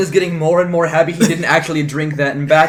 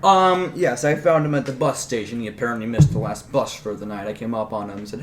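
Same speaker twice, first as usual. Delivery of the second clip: normal talking voice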